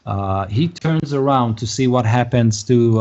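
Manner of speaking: ordinary delivery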